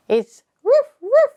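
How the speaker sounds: high, loud playful woof; a high yappy puppy roof roof; dog bark. Shown as a demonstration. Imitating a dog barking.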